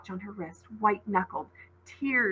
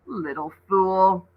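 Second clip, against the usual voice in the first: slow, scornful and slightly louder; scornful sneer; 7 dB louder, slower than usual. A narrator's reading. Seth sneering at Ollie, slow contempt. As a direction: spit the insult down at her.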